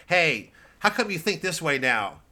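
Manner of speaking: ordinary talk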